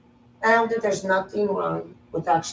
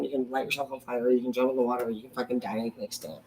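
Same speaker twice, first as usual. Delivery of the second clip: ordinary talk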